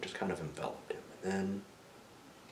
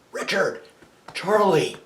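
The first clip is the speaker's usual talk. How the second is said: raised, excited name-calling; yelling out; 10 semitones higher, 9 dB louder, slower than usual. Retold or imitated. Young man hailing his friend in a dream, yelling out. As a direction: call out each name loudly. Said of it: "he yells out".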